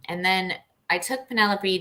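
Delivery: normal talking voice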